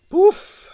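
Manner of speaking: very high nervous poof; nervous dismissive poof; uneasy poof. A narrator's reading. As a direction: puff it out uneasily. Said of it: "said Strogonoff uneasily".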